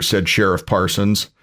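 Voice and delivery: ordinary delivery, nothing marked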